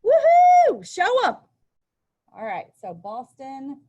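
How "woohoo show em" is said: high, loud whoop of a cheering crowd. Retold or imitated. Teacher voicing the crowd cheering the tea dumping, a loud high whoop. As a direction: cheer them on like onlookers at the docks. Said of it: "people were just like".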